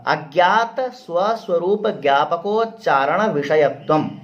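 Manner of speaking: slow, word by word through अज्ञात स्वस्वरूप ज्ञापकोच्चारण विषयत्वम; slow recitation. Shown as a demonstration. Teacher repeating the definition slowly for his students. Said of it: "इसका सीधा क्या है?".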